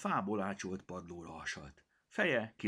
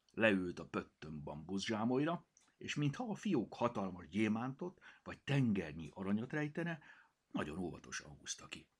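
plain reading voice

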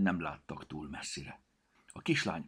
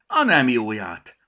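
loud, slow astonished cry, higher; exclaiming; 6 semitones higher, 14 dB louder, slower than usual. Acted. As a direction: shout it out in amazement. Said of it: "kiáltott fel".

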